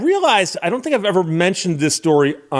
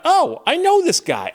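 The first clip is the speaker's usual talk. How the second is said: excited thought; 12 semitones higher, slower than usual. Retold or imitated. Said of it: "And I thought".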